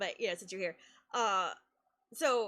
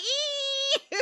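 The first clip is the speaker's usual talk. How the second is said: squeal; 13 semitones higher, 3 dB louder than usual. Retold or imitated. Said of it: "And I'm like".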